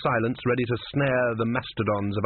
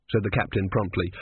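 plain reading voice

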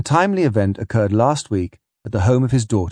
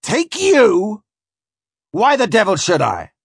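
high, louder, incredulous outburst; outraged shout; 11 semitones higher, 4 dB louder, slower than usual. A narrator's reading. Captain scorning a plea for passage, outraged shout. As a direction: refuse with an indignant yell. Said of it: "cries he, his scar beginning to jump".

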